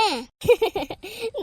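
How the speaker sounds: short, high-pitched burst of laughter; acted giggle; mocking laugh. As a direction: laugh before owning up. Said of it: "அந்த சிரிப்பு சிரிச்ச?".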